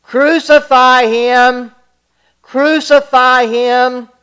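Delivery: a mob baying for blood; frenzied shout. Acted. Priests and police to Pilate, frenzied shouting. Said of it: "they shouted in a frenzy".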